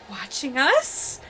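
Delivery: normal talking voice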